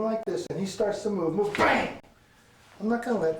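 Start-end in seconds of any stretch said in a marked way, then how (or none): 1.5-2.0 abrupt, high-pitched bang; vocal punch effect; imitated strike. Shown as a demonstration. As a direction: fire it out as the blow lands.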